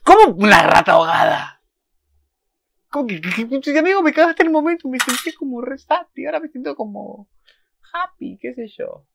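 high, loud, breathless gushing; giddy excitement. Real, not acted. Fan telling the character he ruined the moment, high and giddy. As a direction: squeal about the mood swing.

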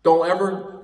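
usual speaking voice

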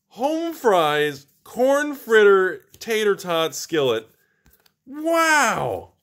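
high, drawn-out cries of disbelief; astonished outcry. Real, not acted. Host stunned by double Lily pull, high astonished cries. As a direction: cry out in stunned disbelief, stretching the words.

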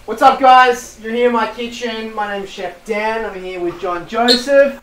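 loud, upbeat cooking-show opener; show host intro. Acted. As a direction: greet the viewers like a TV chef. Chef opens his own show.

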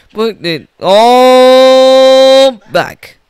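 loud, high, hugely stretched command; fed-up parent hollering; exasperated order. Acted. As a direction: stretch "all" into a long holler. Parent orders food put back.

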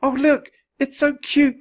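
loud, high-pitched squeal of delight; excited cry. A narrator's reading. Annie sees the tiny monkey, excited cry. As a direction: cry out, thrilled and adoring. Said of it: "cried Annie".

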